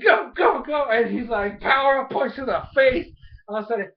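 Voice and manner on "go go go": higher, louder retold cheer, go go go; mock cheering shout. Karina cheering Lucario on in battle, mock loud cheer. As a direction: urge the fighter on with a hammed-up yell. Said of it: "Karina's like".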